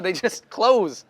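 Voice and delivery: laughing while speaking. Real, not acted. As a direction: chuckle through the words. Laughing about own eyes smiling.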